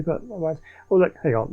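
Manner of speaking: normal talking voice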